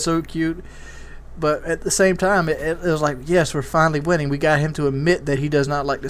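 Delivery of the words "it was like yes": ordinary talk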